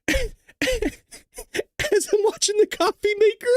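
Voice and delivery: high, slow words broken by laughter; laughing. Real, not acted. Recalling laughing alone by the coffee maker, real laughter. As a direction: laugh through the memory. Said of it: "laughing my ass off".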